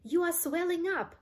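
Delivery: high-pitched alarmed exclamation; panicked high storybook cry; horrified scream. A narrator's reading. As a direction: cry it out in shock. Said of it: "screamed Missus Beauregard again".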